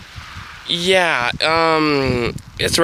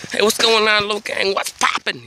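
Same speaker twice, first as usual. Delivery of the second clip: higher mock youtuber greeting; a lightly voiced hyped-up vlogger hello; imitating Logan; 8 semitones higher than usual. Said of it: "Logan, he's like".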